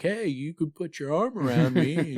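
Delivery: high mock-female invitation; imagined woman's voice. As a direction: put on a high voice, hinting coyly. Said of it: "her to be like".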